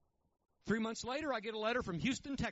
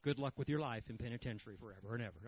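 sarcastic; 9 semitones lower than usual. Pretend. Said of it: "I mean, I didn't say that".

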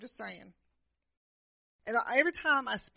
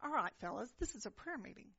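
inner grumble; 10 dB quieter, faster than usual. Retold or imitated. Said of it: "I'm thinking to myself".

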